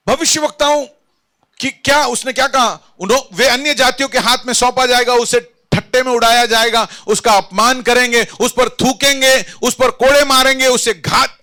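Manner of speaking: emphatic pulpit list; full-force preaching. Acted. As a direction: hammer out each prophecy in turn.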